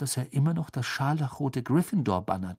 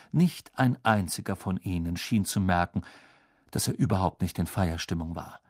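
plain reading voice

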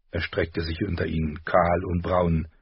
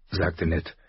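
usual speaking voice

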